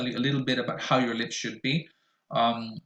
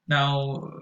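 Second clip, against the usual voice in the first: normal talking voice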